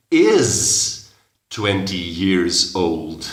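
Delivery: put-on is twenty years old said very slowly; slow model phrase. Shown as a demonstration. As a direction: stretch each word for the learner. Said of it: "Entonces dices". (muy despacio, palabra por palabra)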